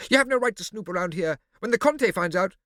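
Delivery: put-on high, loud threatening girl; shrill Morosina. A narrator's reading. Morosina threatening the snooper, high and loud. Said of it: "Morosina's shrill voice".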